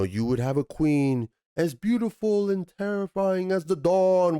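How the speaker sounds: high, airy queenly pronouncement; lightly voiced grand queen; Galadriel quote. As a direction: recite it like an awed elf queen. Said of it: "she's like".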